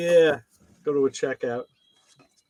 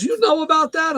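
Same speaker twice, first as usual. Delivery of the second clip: much higher, louder Jamaican question; Jamaican accent; 13 semitones higher, 6 dB louder than usual. Retold or imitated. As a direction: mailman asking in a Jamaican lilt. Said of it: "So he got this Jamaican accent".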